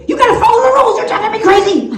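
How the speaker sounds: put-on high, fast childish outburst; exasperated little kid. Little Bobby tells teacher to follow rules, high rushed exasperation. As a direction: rattle it off like a frantic pupil.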